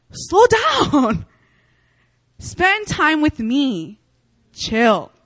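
high, slow sing-song God; playful voice of God. Retold or imitated. God nudging distracted Tina, high and slow. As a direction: tease her gently into slowing down. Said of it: "and God was just like".

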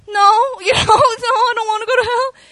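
high, loud, tearful protest; scared child. Retold or imitated. Young girl answering her youth pastor, tearful and scared. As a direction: plead like a frightened kid about to cry. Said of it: "I'm like".

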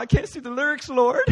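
high, tearful hammed-up complaint; mock crying. Worshipper to the Lord while praising, mock crying. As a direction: wail it like a pretend weeper. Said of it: "all of a sudden you start crying".

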